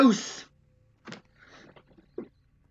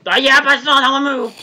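loud insisting; 9 dB louder, faster than usual. Real, not acted. Insists after being caught.

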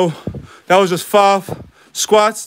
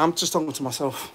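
usual speaking voice

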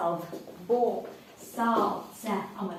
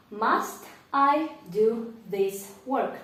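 slow, careful classroom English; slow model question; slower than usual. Shown as a demonstration. Teacher modelling a question slowly. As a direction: spell it out for the class.

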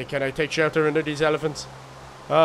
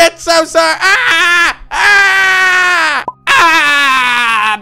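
high, loud hammed-up screaming in pain; mock pain cries; 11 semitones higher, 11 dB louder, slower than usual. Player bitten by a lion in the game, hammed-up pained wailing. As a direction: wail like you've just been bitten.

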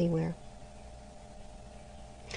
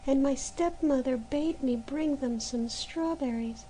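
put-on higher, softer begging girl; desperate plea; 6 semitones higher, 4 dB quieter than usual. A narrator's reading. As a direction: plead for help, near tears. Maruschka begs the months.

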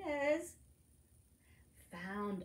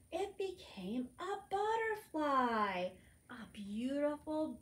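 delighted reveal; 6 semitones higher than usual. Acted.

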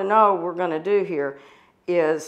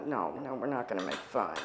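put-on low, hushed quote to children; quietly steer children away from teasing, retold; retold gentle admonishing; 10 semitones lower, 14 dB quieter than usual.

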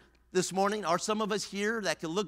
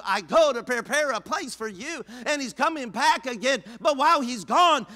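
raised, higher preacher's cadence; preaching at full force; 4 semitones higher, 6 dB louder than usual. Acted. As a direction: drive it home to the congregation.

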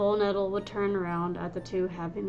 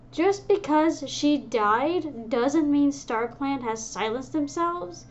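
higher, louder, slow insistence; heated retort; 7 semitones higher, 7 dB louder than usual. Acted. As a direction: counter hotly, pushing back hard. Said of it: "Dustbriar countered heatedly".

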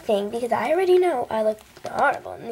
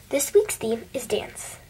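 slow secretive whisper; slower than usual. Acted. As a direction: let them in on a secret. Announcing the theme secretively.

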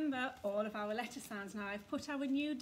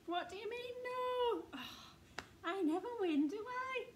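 much higher, hammed-up disbelief; mock outrage; 10 semitones higher, 3 dB louder than usual. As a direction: play the sore loser for the kids. Teacher pretends to lose game.

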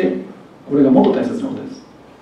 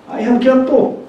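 日本語訛りで catをキャットと高めに言う; 日本語訛り丸出しでI have catを読む; 日本語訛りの英語; 10 semitones higher, 4 dB louder, much slower than usual. Retold or imitated. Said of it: "訛りで溢れてますからね". (日本語訛りのカタカナ英語)

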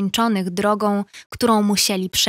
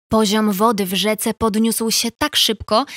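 ordinary delivery, nothing marked

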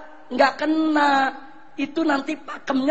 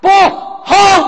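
loud, high cry of liar; shouted denial; 6 semitones higher, 9 dB louder than usual. Real, not acted. Preacher rejecting the claim with a shout. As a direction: throw the word out in outrage.

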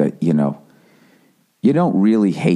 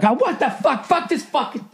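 mock freak-out; 3 dB quieter than usual. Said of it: "I would flip out".

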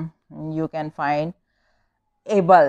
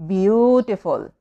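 beautiful slowed, first syllable stretched; beautiful drawn out; 3 semitones higher, slower than usual. Shown as a demonstration. Teacher sounding out syllables for pupils, beautiful said slowly. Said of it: "there are four syllable".

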